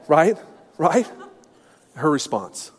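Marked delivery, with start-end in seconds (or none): none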